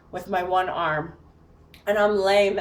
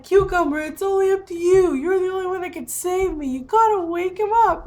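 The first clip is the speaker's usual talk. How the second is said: high, raised pleading voice; mock desperate plea; 11 semitones higher, 3 dB louder than usual. Locked-out woman begging cat to wake partner, mock desperate plea. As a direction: implore a pet as your only rescuer, hammed-up.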